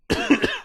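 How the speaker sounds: sharp high choking cough; coughing up water. Real, not acted. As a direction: clear the throat after choking. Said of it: "My water went down the wrong hole".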